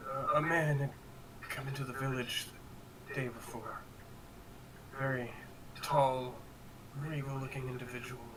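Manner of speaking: lightly voiced rattled villager; halting, hushed and hesitant; shaken survivor. Survivor NPC recounting the stranger.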